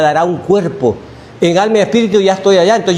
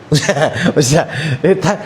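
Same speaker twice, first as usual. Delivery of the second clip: chuckling through the words; amused laugh at the funeral talk; 4 semitones lower than usual. Real, not acted. Chuckles at mourners' praise.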